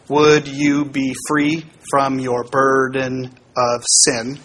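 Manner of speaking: counting out each syllable slowly. Shown as a demonstration. Teacher counting syllables slowly for the class. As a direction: spell out the meter slowly. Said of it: "Ten syllables".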